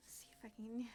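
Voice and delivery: whispered aside to herself. Real, not acted. Streamer holding snowflakes down, whispered to herself. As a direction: hush it while you struggle with the paper. Muttering while holding paper down.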